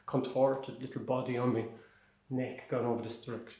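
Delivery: ordinary delivery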